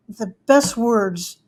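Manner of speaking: ordinary talk, nothing marked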